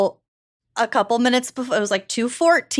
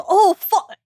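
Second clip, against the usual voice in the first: startled quote; 8 semitones higher than usual. Retold or imitated. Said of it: "And he goes".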